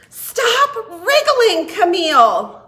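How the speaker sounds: loud, high, stretched-out yell; shout. A narrator's reading. Frog yelling at a wriggling Camille, shouting. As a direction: yell it out in frustration. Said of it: "I shouted".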